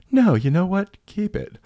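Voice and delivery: imitating himself high on laughing gas; drugged-up voice. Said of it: "in my stupid drugged up head".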